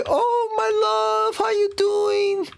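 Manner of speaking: very high, drawn-out cooing to a lover; hammed-up sweetheart in a slow high voice; imitated lovesick greeting. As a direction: greet your darling in mock falsetto. Revived lover greets him at grave.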